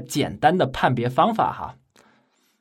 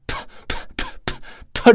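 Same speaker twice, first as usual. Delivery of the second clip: repeated breathy "p" bursts, slow and forceful; straining to shout a consonant; puffed p sounds; 9 semitones higher than usual. Shown as a demonstration. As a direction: push out "p" hard over and over. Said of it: "比如辅音音素，p".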